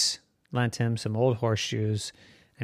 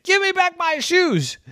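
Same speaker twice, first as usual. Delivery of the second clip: high, loud demand; shouting farmer; 4 dB louder than usual. Acted. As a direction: yell it after the runaway pig. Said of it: "And Farmer said".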